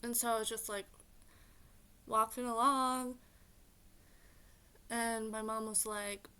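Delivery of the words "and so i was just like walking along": ordinary talk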